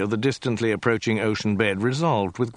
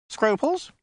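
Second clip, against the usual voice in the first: helpful suggestion. A narrator's reading. Other head supplies the word.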